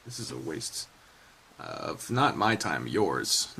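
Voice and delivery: self-deprecating mutter. Real, not acted. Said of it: "I'm a fucking idiot".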